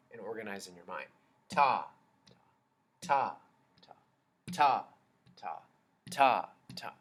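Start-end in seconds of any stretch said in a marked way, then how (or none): 1.5-6.5 some ta punched louder than the rest; accented ta. Shown as a demonstration. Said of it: "it sounds the same".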